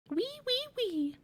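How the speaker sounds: high squeaky piglet voice; little pig squeal. Acted. Piglet squeals his little song.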